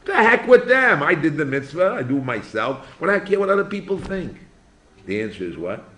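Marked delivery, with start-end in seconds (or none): 0.0-4.4 loud, high mock protest; a hammed-up indignant excuse; dismissive objector. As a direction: play the shrugging skeptic who sees no problem. Rabbi voices a dismissive objector.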